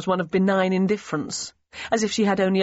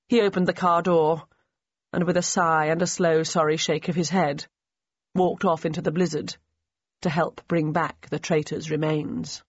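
plain reading voice

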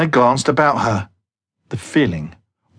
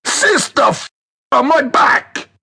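pained cry; 12 semitones higher than usual. Acted. Landed painfully on his back.

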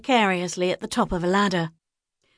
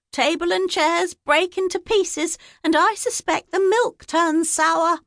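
put-on high, chattering old gossip; lightly voiced village busybody savouring the scandal; high gossiping woman's voice; gossipy village woman; 9 semitones higher than usual. A narrator's reading.

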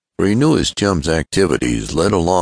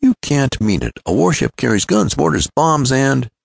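high, protesting disbelief; incredulous cry; 11 semitones higher than usual. A narrator's reading. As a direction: object in astonishment, pitched up until cut off. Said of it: "cried Ned".

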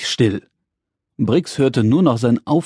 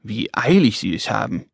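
gruff, grumpy; 4 semitones lower, 4 dB quieter than usual. Acted. Little man watches family rush off, grumpy. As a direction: lower grumble of a little man. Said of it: "dachte der kleine rosa Mann unwirsch".

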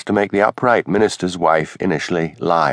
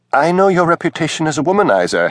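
higher, flustered minister's wife; rising higher as she gets flustered; 8 semitones higher than usual. A narrator's reading. Mrs Wellington accuses Hamish.

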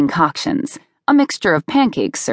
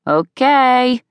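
higher, sing-song reply called back; distracted mom answering; 7 semitones higher than usual. A narrator's reading. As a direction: answer the kid without getting up. Mom half-answers while reading.

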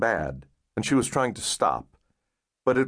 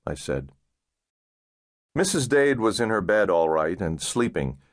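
usual speaking voice

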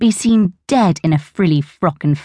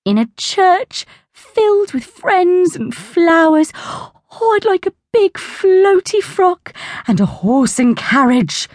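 high, far-away dreamy voice; dreamily; 11 semitones higher, slower than usual. A narrator's reading. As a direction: drift off into a daydream. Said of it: "said Auntie Glo dreamily".

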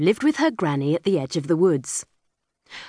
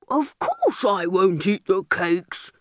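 bossy bear. A narrator's reading. Big bear tells captured girl to work for him. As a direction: growl orders at the little girl.

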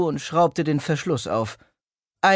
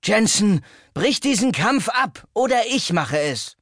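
firm, raised, angry command; firm angry order; 6 semitones higher than usual. A narrator's reading. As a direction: order the fight stopped, voice hard with anger. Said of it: "Sie klang fest ... die Wut".

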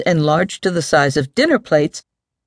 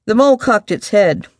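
usual speaking voice

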